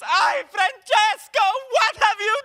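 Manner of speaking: very high, slow anguished wail; screaming at himself. Retold or imitated. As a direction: wail it like an opera tragedy. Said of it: "starts screaming at himself and goes".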